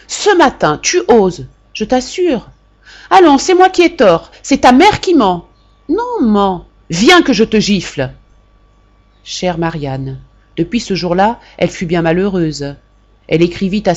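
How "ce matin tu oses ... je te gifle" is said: much higher and louder, outraged retort; mother outraged at her son's answer; enraged mother. A narrator's reading. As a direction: fling his words back at him. Mother explodes at son.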